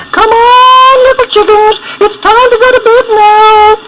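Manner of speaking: high, clucky mother hen voice; a fussy hen teaching her chicks; mother hen talk. A narrator's reading. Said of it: "she seemed to be saying".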